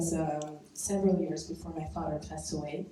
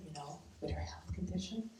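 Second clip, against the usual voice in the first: whispered aside; 9 dB quieter than usual. Real, not acted. Confiding aside.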